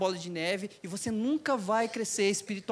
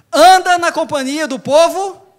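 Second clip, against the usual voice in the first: much louder and higher proclamation; proclaiming; 7 semitones higher, 13 dB louder than usual. Acted. Preacher crying out the verse to the congregation, loud. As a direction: cry out the verse to the church.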